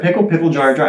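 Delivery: usual speaking voice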